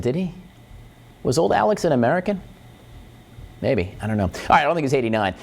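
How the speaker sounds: usual speaking voice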